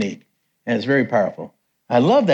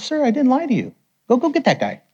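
high-pitched imitated plea; retold urgent protest; 11 semitones higher than usual. Interpreter answering the accusation, urgent and high. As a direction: act out a flustered, higher-voiced protest. Interpreter defends himself, sends for man.

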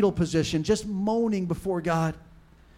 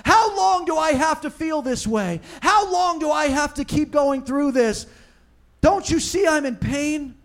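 high, pleading cry to God; anguished cry out; 9 semitones higher, 3 dB louder than usual. Acted. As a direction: cry out in pain, demanding an answer. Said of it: "And then he cries out".

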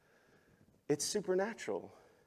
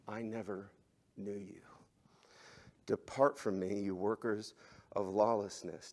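much lower and much softer, a solemn final verdict; grave hush; 6 semitones lower, 7 dB quieter than usual. Acted. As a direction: say it low and quiet like a judge's last word. Jesus voiced rejecting false followers.

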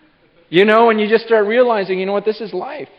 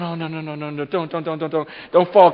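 anxious pleading; 5 semitones lower than usual. Acted. Watching balance beam nervously.

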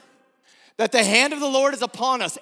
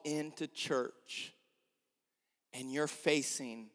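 soft closing appeal; 7 semitones lower, 7 dB quieter than usual. Acted. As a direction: invite them in gently.

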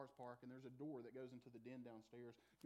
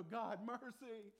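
pleading cry; 8 semitones higher, 7 dB louder, much slower than usual. Acted. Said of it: "we will call out to Him and go".

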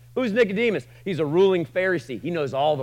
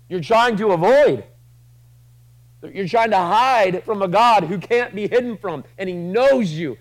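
rising, louder preacher's push; preaching hard; 6 semitones higher, 7 dB louder than usual. Acted. Preacher to the congregation, loud and pressing.

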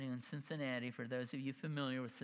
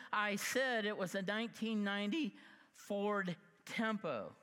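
louder, higher, indignant retort; retold angry comeback; 6 semitones higher, 4 dB louder than usual. Standing up to the finance guy, indignant and loud. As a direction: fire it back at the dealer, done deal.

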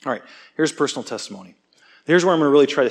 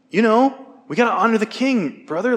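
much higher gentle appealing voice of a friend; imitating friend gently; 9 semitones higher, 3 dB louder than usual. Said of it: "Next one".

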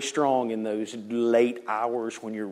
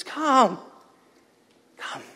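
tender invitation; 4 semitones lower, 9 dB quieter than usual. Acted. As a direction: invite them in softly, with long pauses. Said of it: "stands like this and says".

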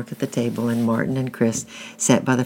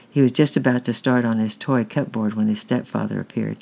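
usual speaking voice

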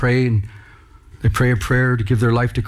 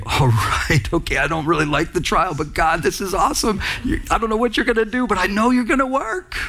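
acted-out believer, climbing higher and higher; upbeat acceptance; 7 semitones higher than usual. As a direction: voice a trusting prayer that lifts to a high finish. Voices a believer accepting a trial.

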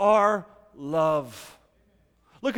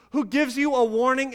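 ordinary talk, nothing marked

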